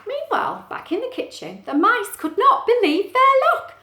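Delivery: high, animated picture-book reading; excited storytime narrator; story narration. Acted. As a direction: build excitement for young listeners. Reads story aloud.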